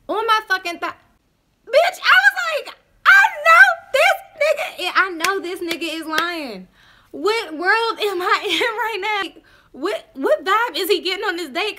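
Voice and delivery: high, loud, laughing outrage; outraged disbelief. Real, not acted. Telling listeners how he groped her thigh, shrieking outrage. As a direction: scream it in shocked disbelief.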